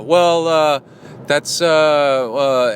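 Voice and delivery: cornered cyclist fumbling; flustered stammer. Retold or imitated. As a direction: drag out the guilty hesitation. Caught drafter stammers again.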